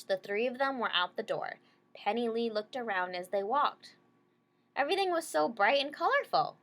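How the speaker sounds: ordinary delivery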